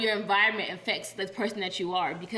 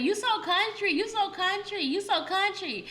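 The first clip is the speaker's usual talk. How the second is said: high-pitched, repetitive mock teasing; mimicked taunting; 10 semitones higher, slower than usual. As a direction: chant it like a nagging tease. Mimics repeated teasing heard in Boston.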